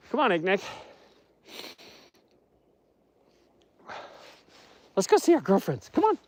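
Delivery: very high coaxing pet-talk; calling to dog. Real, not acted. Owner urging dog to visit girlfriends, high coaxing voice.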